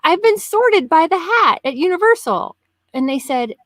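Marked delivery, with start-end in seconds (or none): 0.0-2.5 high-pitched, indignant self-defence; playfully affronted retelling; mock offended. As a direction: protest as if your honour is insulted. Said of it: "so offended".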